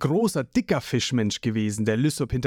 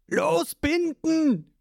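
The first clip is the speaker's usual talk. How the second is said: Zorro shouting; 14 semitones higher, much slower than usual. A narrator's reading. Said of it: "schreit Zorro im Hintergrund".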